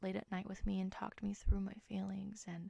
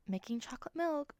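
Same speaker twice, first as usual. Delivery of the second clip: higher, chirpy quoted text; quoting dad's text; 8 semitones higher than usual. Retold or imitated. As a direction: lift the voice to read dad's text. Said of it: "I just said, like".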